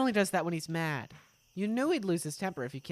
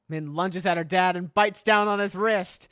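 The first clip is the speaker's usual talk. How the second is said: ordinary talk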